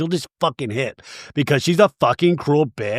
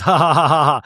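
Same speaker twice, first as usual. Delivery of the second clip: mock laugh; 5 dB louder, faster than usual. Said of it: "And Lisa goes".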